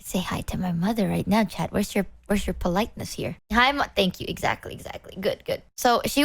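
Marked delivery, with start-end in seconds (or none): 0.0-1.7 secret whisper aside; whispering to chat behind mom's back. Real, not acted. Aside to chat about mom. 4.3-5.2 whisper. Real, not acted. Aside to chat about mom.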